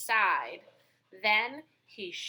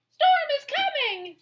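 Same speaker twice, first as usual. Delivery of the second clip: very high, loud chorus; all shout it at once; animals in chorus; 8 dB louder than usual. Acted. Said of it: "the animals told her all at once".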